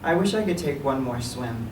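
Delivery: ordinary delivery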